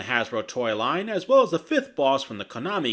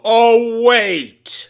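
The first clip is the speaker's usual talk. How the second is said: loud, high fake surprise; mock realization; 10 semitones higher, 12 dB louder than usual. Real, not acted. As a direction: shout it like a sudden idea. Sarcastic punchline.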